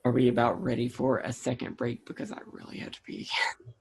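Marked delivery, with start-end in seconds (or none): 2.1-3.7 sheepish whisper. Real, not acted. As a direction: hush it like a confession. Confessing needing a break.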